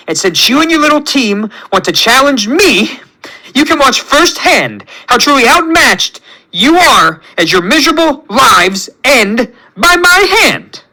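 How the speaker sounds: high-pitched, theatrical villain threat; menacing villain rant. A narrator's reading. Villain challenged by the little team, ranting threateningly.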